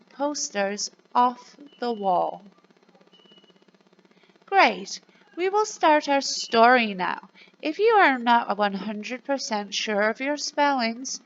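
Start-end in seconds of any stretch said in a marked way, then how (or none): none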